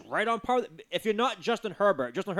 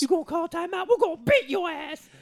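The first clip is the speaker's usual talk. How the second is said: high taunting fan voice; cocky trash-talking fan; mock Raiders fan; 12 semitones higher, 3 dB louder than usual. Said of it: "that was a Raiders fan making that judgment call".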